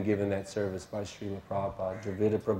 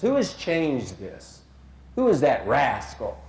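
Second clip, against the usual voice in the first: slow, raised demand of an indignant guru; emphatic and heavy; 4 semitones higher, 4 dB louder, slower than usual. Retold or imitated. Guru hunting whoever changed the word, heavy indignation. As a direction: demand it slowly, hitting the key words hard. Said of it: "he was so emphatic and heavy about this point".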